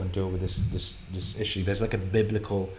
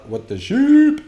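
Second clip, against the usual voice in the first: What the sheep squeaked much higher and louder; squeaky silly voice; 11 dB louder, slower than usual. Pretend. Streamer playing with the bug pun, squeaky and silly. Wordplay repetition.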